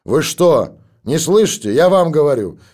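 high, loud scolding from behind a door; angry voice through the door. A narrator's reading. Irritated neighbour shouts through the door, angry. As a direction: threaten to call the captain, loudly. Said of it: "донеслось снова из-за двери".

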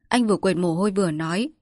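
ordinary delivery

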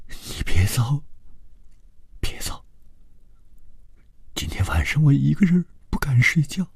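high, whispery, fearful plea; frightened pleading. A narrator's reading. Secretary clinging to departing man, frightened whispered pleading. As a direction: beg in a small, breath-filled high voice. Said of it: "高伟又突然跑过去抱住他说".

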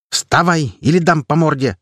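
loud rough threat, high and forceful; unwomanly shout. A narrator's reading. Girl threatens to punch him in a mannish yell. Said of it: "крикнула Захария, совсем уж не по-женски".

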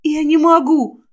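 ordinary delivery

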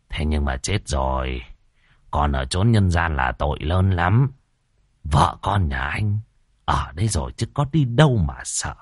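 put-on deep, grave old master; low stern sorcerer. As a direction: speak low like a solemn shaman. Tam persuading ghost.

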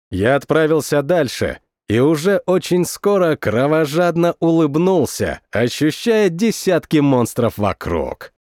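ordinary delivery

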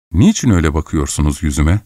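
plain reading voice

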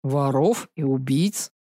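gloomy dragon. Acted. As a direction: answer darkly like a sullen dragon. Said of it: "мрачно конкретизировал дракон".

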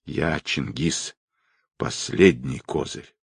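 slow, low dying voice; groaning. A narrator's reading. Wounded man giving his name to the colonel, groaning. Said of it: "простонал тот".

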